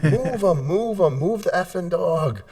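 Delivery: high mocking girlfriend voice; a girlfriend teasing her boyfriend, high and mocking; girlfriend quoting him. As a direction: mimic her teasing him about his sleep-yelling. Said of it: "My girl has told me".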